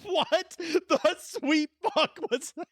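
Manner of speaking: high, slow shocked question; can't believe what just came out; high drawn-out disbelief; stunned disbelief. Real, not acted. Shocked at own flub.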